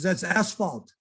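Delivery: normal talking voice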